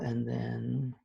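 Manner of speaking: ordinary delivery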